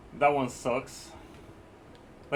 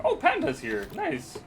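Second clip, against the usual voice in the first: happy; 3 dB louder than usual. Retold or imitated. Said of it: "I was like".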